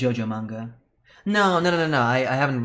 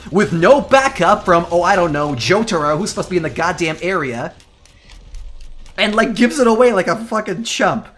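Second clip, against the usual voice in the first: louder, slowed, indignant rant, leaning hard on each phrase; 5 semitones higher, 6 dB louder than usual. Real, not acted. Fan ranting to chat about Polnareff's dumb death, loud and indignant. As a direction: spit it out like a fan betrayed by the show. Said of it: "This is my own personal rant".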